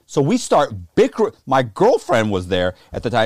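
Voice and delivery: normal talking voice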